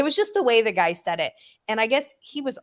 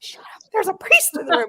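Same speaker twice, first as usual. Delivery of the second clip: hushing scold; 12 semitones higher, 4 dB louder than usual. Retold or imitated. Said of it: "my mom's like".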